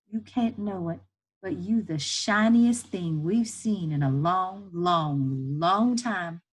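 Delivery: lightly voiced Southern woman, drawn out and emphatic; slow, heartfelt emphasis from an older comforter; moved, reassuring woman. Woman telling boy what seeing him means, moved.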